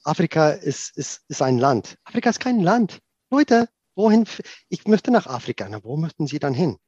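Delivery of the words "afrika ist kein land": kein rising and higher; stress on kein. Real, not acted. Correcting a common cliché about Africa, kein stressed. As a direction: lean hard on kein. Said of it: "correcting stereotype, stress on kein".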